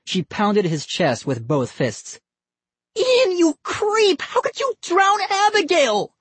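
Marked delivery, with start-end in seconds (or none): 3.0-6.1 furious accusation. A narrator's reading. Molly screams at Ian for drowning her doll, furious. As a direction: hurl the blame at him. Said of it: "too furious to hear him".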